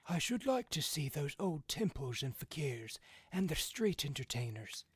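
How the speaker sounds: put-on longingly, like an old man. A narrator's reading.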